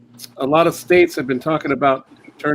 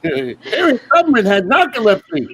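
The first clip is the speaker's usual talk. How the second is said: high, eager know-it-all voice; 10 semitones higher, 3 dB louder than usual. Retold or imitated. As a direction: share trivia like an overeager colleague. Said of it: "they're like".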